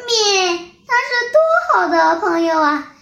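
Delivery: a lamb whimpering over a lost friend, lightly; sobbing. A narrator's reading. Little lamb mourning the wolf's farewell letter, light sobbing. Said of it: "小羊羔抽抽搭搭地说".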